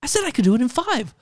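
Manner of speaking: high, mocking whine; whiny put-upon scientist voice; exasperated character. Mocking the TV scene's contradiction in a high whine. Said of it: "And it's like".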